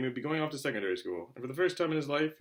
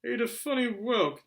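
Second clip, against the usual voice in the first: higher and slow; pompous uncle; 10 semitones higher, 4 dB louder, slower than usual. Acted. Uncle Vernon on Marge's illness, high and slow. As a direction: deliver it with pompous disgust.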